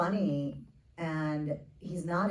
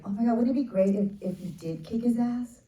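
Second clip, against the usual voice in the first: higher, louder and fast excited rush; excited idea; 7 semitones higher, 6 dB louder than usual. Retold or imitated. Retelling her own lightbulb moment about the film, excited. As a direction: burst out with the idea.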